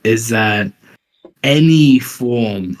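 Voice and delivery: normal talking voice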